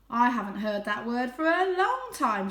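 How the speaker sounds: lighter, brighter cat voice; cat Tam voice. A narrator's reading. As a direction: play the friendly cat.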